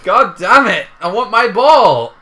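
high, loud wail of frustration; frustrated yell. Real, not acted. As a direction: yell it, stretching out the demand. Lost the ball in game.

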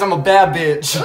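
usual speaking voice

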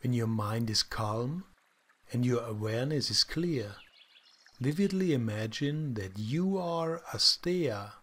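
calm, soft guided meditation voice; soft spa whisper. Acted. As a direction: guide them gently into stillness.

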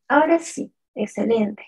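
normal talking voice

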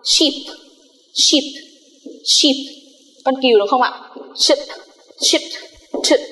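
"sheep" said with a long ee, repeated slowly; teacher modelling a word for the class; model pronunciation. Shown as a demonstration. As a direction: say "sheep" with a stretched ee each time. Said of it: "Chúng ta sẽ lấy ví dụ như sau".

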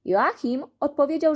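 ordinary delivery

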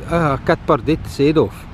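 ordinary talk

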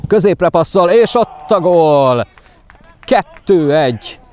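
high, drawn-out goal call; excited hungarian sports commentary. Real, not acted. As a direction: stretch out the goal cry. Goal called live.

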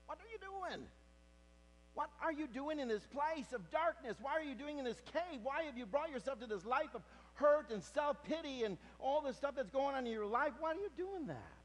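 high, loud preaching build; full-force preaching. Acted. Preacher telling the congregation of Elijah's cave, loudly. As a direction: drive the story home to the congregation.

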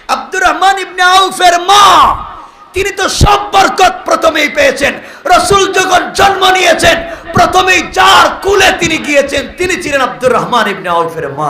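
high, loud shouted sermon cry; full-force preaching. Acted. Preacher rousing the gathering with a fervent high shout. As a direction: rouse the crowd with a fervent shout. Sermon climax on the Prophet's birth.

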